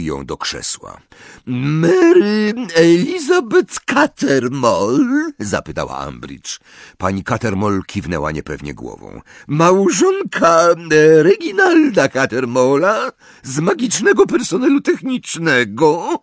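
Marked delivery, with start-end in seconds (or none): 1.5-5.3 high, slow, drawn-out questioning; syrupy official voice, pitched way up; velvety interrogator. A narrator's reading. Ambridge questions Mrs Cattermole, high velvety drawl. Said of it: "swoim cichym, aksamitnym głosem". 9.5-16.2 syrupy official voice, pitched way up; velvety interrogator. A narrator's reading.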